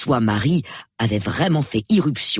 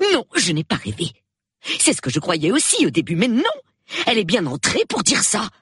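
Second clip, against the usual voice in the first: high, insistent wife; indignant protest; 8 semitones higher than usual. Acted. Wife denies to husband that she dreamt, indignant. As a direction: protest in a raised pitch.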